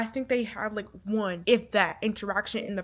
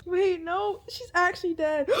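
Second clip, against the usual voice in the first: high, stunned near-crying voice; tearful shock; 7 semitones higher than usual. Real, not acted. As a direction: choke up in high-pitched disbelief. Shocked that a character died.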